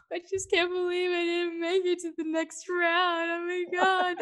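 high, mock-tearful wail; mock crying contestant. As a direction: ham up a heartbroken baker. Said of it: "these people are crying. They're like".

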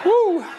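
high, loud "woo"; loud preacher's holler, pitched high; exultant whoop. Real, not acted. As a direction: let out a fired-up praise whoop. Whooping after joking about combusting.

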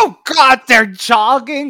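loud exasperated diva; Miss Piggy. Retold or imitated. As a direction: groan it like a pampered diva. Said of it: "She's like".

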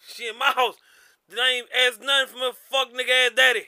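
normal talking voice

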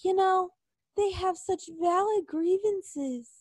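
mock them in a prissy put-on voice. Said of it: "they're like".

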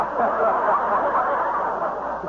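airy chuckle on arrival; a quick airy laugh as he enters; breathy guest laugh. Acted. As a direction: laugh your way in the door.

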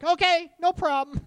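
high, loud, chirpy brush-off; breezy no problem. Retold or imitated. Host answering shy guys, high and breezy. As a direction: toss it off lightly and brightly. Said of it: "I'm like".

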